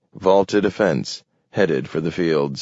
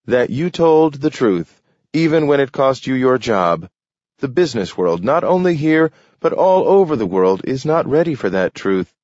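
higher, louder, fervent speech; an official lecturing a job seeker, higher and louder; impassioned official; 8 semitones higher, 4 dB louder than usual. A narrator's reading. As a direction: preach the truth with rising conviction. Taylor lectures passionately.

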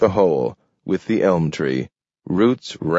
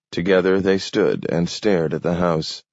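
plain reading voice

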